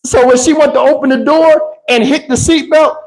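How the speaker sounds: ordinary talk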